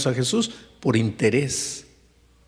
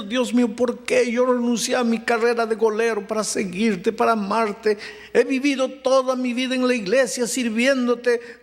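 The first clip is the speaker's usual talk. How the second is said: higher, urgent preaching of the doctor's despair; impassioned preaching; 9 semitones higher than usual. Acted. Pastor voicing the dying doctor's despair to the church, impassioned. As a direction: pour out the sick doctor's anguish from the pulpit.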